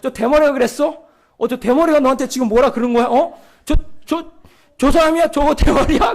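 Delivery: loud, high mock outrage; retold anger. Youth president angry at the pastor on his friend's behalf, retold anger. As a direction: act out a friend getting worked up for you. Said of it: "저 대신 막 화를 막 내는 거예요".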